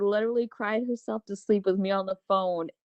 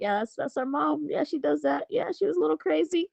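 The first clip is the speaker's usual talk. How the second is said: put-on higher, teasing kid voice; imitating her kids; 7 semitones higher than usual. Mom voicing her kids describing her, higher and teasing. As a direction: mimic the kids shrugging off mom.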